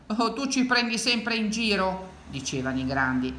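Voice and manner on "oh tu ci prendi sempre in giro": higher-pitched mild reproach; lightly acted scoffing; dismissive grown-ups. A narrator's reading. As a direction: brush off the boy's alarm. Grown-ups dismiss the boy's cries.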